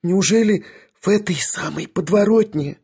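high, plaintive question; tearful disbelief. A narrator's reading. Young lady learns the truth about him, tearful disbelief. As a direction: ask in a high, distressed voice.

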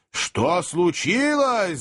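slow, high-pitched demanding roar. A narrator's reading. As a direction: bellow it, drawing out each word. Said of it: "заревел хозяин дома".

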